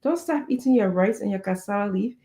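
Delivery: usual speaking voice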